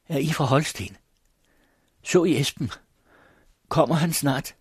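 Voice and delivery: high, loud and eager, pressing for news; eager questions. Acted. Mad Cecilia asks the traveller about Esben, eager and high. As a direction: ask fast and hopeful, voice lifted high. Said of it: "spurgte mig ivrigt".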